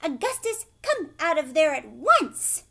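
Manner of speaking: loud high scolding; scolding call. A narrator's reading. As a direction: order him out. Mother ordering son out.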